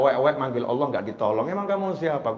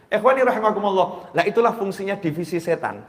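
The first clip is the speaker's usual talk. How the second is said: normal talking voice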